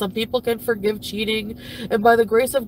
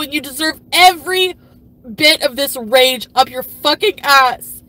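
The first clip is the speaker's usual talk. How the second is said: loud, cutting tirade; furious rant; 6 dB louder than usual. Real, not acted. Ranting at the woman who took her husband, furious. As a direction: spit every insult at her.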